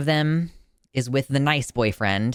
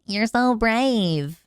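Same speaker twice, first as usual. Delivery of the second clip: high, slow, syrupy "you're so brave"; mock gushing praise; 11 semitones higher, 4 dB louder, much slower than usual. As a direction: coo it like a patronizing well-wisher. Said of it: "you'll hear".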